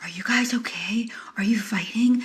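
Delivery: hushed, whispered check-in; acted-out whispered concern; concerned near-whisper. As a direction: whisper it as a worried onlooker stepping in. Bartender checks on the yelling pair.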